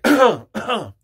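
loud, forced throat-clearing; loud fake cough. Shown as a demonstration. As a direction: cough loudly on purpose. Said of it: "like, cough loud".

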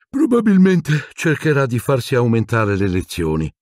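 said through a yawn; lazy yawning teenage quip. A narrator's reading. Ron joking about Hermione through a yawn. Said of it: "con uno sbadiglio".

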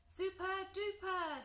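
high-pitched excited exclamation. A narrator's reading. Sparky the dragon cheering at the praise, excited. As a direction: burst out with delight. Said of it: "said Sparky excitedly".

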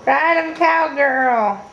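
high, loud cowboy cheer; playful cheer. Real, not acted. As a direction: cheer her on like a rodeo. Cheering toddler rocking on toy.

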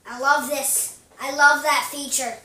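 delighted, loud I love that; gushing over the gun, laughing in; excited. Real, not acted. Player gushing over his gun's feature, excited and loud. Gleeful about a game feature.

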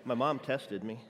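usual speaking voice

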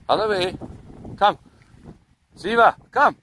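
loud call to the dog. Real, not acted. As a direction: call the dog back firmly. Calling dog.

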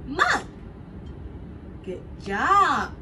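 ordinary talk

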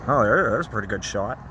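normal talking voice

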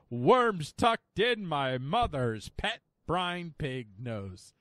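slow, emphatic mock-dramatic reading, dropping at the end; grand declamation. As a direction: proclaim nonsense like great poetry. Reads nonsense text aloud grandly.

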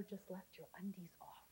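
a cheeky whispered question to the room. Acted. Speaker whispers a naughty tip to the audience. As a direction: lean in and whisper it like a naughty secret.

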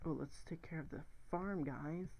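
normal talking voice

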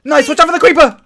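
high, loud alarm call; excited, panicky yelled warning; urgent warning shout. Real, not acted. Warning teammate of creeper.

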